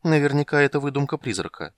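ordinary delivery, nothing marked

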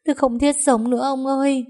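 high, sustained acted wail. A narrator's reading. Wife despairing to her husband, wailing. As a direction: cry out in hammed-up despair. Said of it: "lại khóc thống thiết không ngừng".

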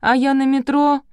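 high, loud wail of complaint; whining.

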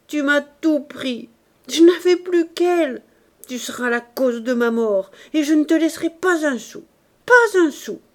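high, loud, weepy wail; tearful outburst. Acted. Old uncle weeping loudly at his nephew, tearful rage. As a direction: cry it out, voice climbing to a howl. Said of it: "gagné de nouveau par les larmes".

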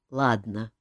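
"Ладно" said low and resigned with a sigh; king gives in and sighs. A narrator's reading. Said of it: "вздохнул король".

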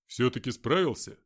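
a hint of a gruff fighter; lightly voiced tough soldier. Mark greets them.

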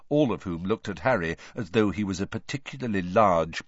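ordinary delivery

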